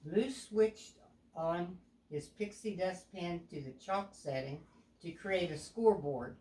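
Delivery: ordinary delivery, nothing marked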